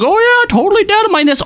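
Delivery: high, fast mocking imitation. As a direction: sneer it in a silly high voice. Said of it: "being like".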